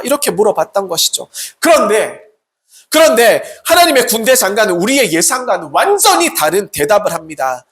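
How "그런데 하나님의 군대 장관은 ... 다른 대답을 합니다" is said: ordinary talk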